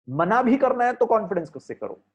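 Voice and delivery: usual speaking voice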